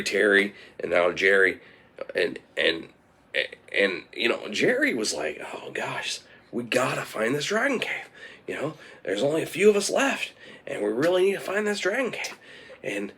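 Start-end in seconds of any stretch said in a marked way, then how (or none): 5.5-12.4 worried plea. Acted. Jerry despairs as farmers keep dying.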